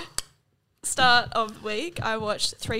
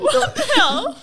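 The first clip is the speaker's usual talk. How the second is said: much higher, louder, slowed, laughing; laughing disbelief; 14 semitones higher, 8 dB louder than usual. Real, not acted. Friend shocked by a film casting fact, laughing squeal. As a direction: squeal it out through a laugh, can't believe it. Hearing Mira's British accent.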